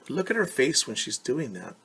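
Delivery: usual speaking voice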